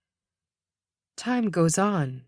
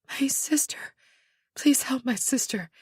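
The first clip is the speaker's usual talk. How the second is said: high, breath-thin begging; weak plea; 5 semitones higher than usual. Acted. Injured woman begging rescuer to help her sister, weakly pleading. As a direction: plead with barely any voice.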